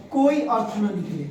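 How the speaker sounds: ordinary delivery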